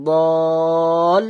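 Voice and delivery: দল held very long and loud, closing on l; elongated, lam joined. Shown as a demonstration. Teacher showing the join, long loud দল. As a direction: draw out দল, then join the lam. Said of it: "যুক্ত হলো".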